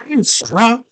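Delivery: high little mock call. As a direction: imitate calling down from a wall, high-pitched. Hoggle calls down from wall.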